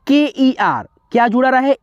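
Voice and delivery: usual speaking voice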